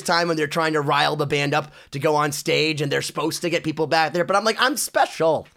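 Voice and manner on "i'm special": higher mock-entitled insistence; self-important protest. Obnoxious kid insists the band likes him, mock entitled. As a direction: plead your special status, half laughing at yourself. Mocks own pleading at being removed.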